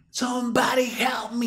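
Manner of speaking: high slow shout in English; shouting for help. Retold or imitated. Friend shouting to casino crowd for help, high and slow. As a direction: yell it slowly for help. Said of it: "lo dice duro y con fuerza".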